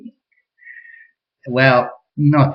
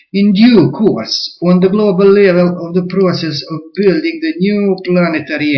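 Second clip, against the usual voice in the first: put-on Russian accent; 7 semitones higher, 5 dB louder than usual. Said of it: "I forgot my Russian accents".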